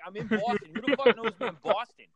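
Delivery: retold disbelief, pitched high; bewildered. Acting his bewildered reaction in story.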